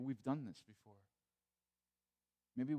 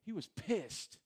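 higher and louder, punching the word; emphatic outrage; 6 semitones higher, 4 dB louder than usual. Real, not acted. Pastor stressing Jesus' anger to church, emphatic. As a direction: let the outrage burst out. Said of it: "Can I say the P word in church?".

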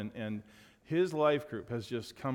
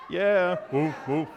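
cheering; 4 semitones higher, 7 dB louder, slower than usual. Real, not acted. Pastor cheering the congregation.

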